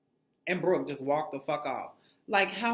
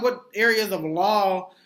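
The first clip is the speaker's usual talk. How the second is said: mock prim question; 4 semitones higher, 7 dB louder than usual. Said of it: "Pretty much like".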